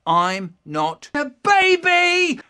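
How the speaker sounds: high, loud, slow shout; indignant shout. Acted. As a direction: yell it out offended, stretched slow. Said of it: "my brother suddenly goes".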